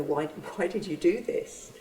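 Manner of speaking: normal talking voice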